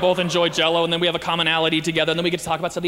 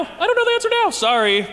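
much higher, meek little confession; hammed-up timid apology; sheepish apology; 11 semitones higher, 4 dB louder than usual. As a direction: squeak it out like a nervous student. Believer caught without answer.

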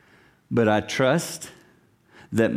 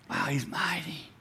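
hushed, breathless wow; awed whisper; 4 semitones higher, 11 dB quieter than usual. Retold or imitated. Preacher whispering his awe at God's might.

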